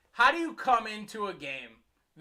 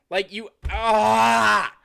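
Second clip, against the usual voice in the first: frustrated groan; 2 semitones higher, 7 dB louder, much slower than usual. Real, not acted. Frustrated at the team's play.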